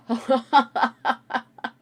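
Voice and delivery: loud burst of real laughter. Real, not acted. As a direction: laugh out loud after the shock. Laughs after sour candy.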